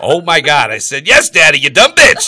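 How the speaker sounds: very loud, high-pitched yelling; explosive mock outrage; exasperated shout. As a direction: yell it as if they are deaf. Exasperated at not being heard.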